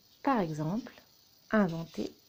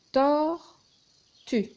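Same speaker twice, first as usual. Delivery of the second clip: "Tortue" in two loud, high, separated syllables; 7 semitones higher, 7 dB louder than usual. Shown as a demonstration. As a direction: punch out both halves of "Tortue", pausing between. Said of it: "nombre de syllabes de ces mots".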